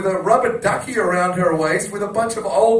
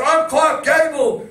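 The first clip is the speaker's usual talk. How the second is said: high, loud, drawn-out proclamation; hammed-up triumphant show-off cry; imitated drunken boast; 8 semitones higher, 5 dB louder, slower than usual. Drunk mate spinning round a pole, exuberant proclamation.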